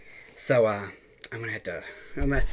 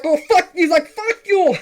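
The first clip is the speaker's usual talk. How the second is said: much higher, louder mock Indian caller yelling; angry scammer; 6 dB louder than usual. Retelling the scammer's outburst in a yelling mock Indian accent. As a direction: blow up like the furious Indian caller.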